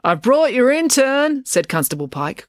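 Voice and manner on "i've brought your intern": high, drawn-out call through a door; slow, high sing-out. A narrator's reading. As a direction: call out to someone inside, stretching it out. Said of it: "calling through the screen door".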